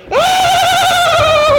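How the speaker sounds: very high-pitched squeal of excitement; imitated girlish excited scream; excited shriek. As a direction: shriek with delight, high and loud. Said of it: "'Cause she'd go".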